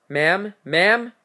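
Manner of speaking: officer urgently checking on a caller; alarmed calling out. Acted. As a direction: call out for her in acted alarm. Officer calls to screaming caller.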